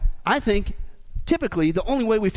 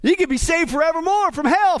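normal talking voice

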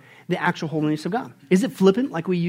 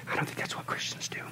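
hushed whisper; whispered aside; 12 dB quieter than usual. Real, not acted. Preacher whispering a point to the congregation. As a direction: lean in and whisper it.

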